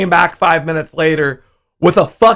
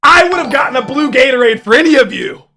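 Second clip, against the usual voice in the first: high, raised reproach; raised indignant telling-off; 8 semitones higher, 4 dB louder than usual. Retold or imitated. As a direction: scold the whole family, wounded. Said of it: "and I said".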